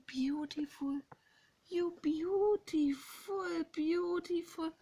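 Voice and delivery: high, drawn-out cooing; cooing admiration. Real, not acted. Woman admiring a pair of birds, cooing. Gushing at birds she is watching.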